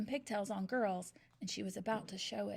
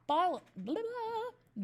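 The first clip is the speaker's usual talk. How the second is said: usual speaking voice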